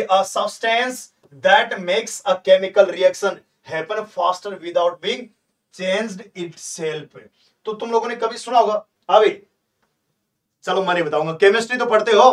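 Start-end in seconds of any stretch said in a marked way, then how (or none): 0.0-7.2 slow dictation. Shown as a demonstration. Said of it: "इसको ध्यान से देखो".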